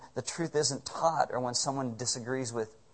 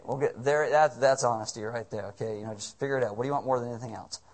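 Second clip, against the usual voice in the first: normal talking voice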